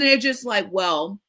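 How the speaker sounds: ordinary talk, nothing marked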